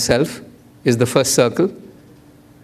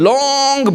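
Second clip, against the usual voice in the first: LONG stretched out high; drawn-out LONG; 10 semitones higher than usual. Shown as a demonstration. Storyteller describing a banner, LONG drawn out. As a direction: stretch the word LONG right out.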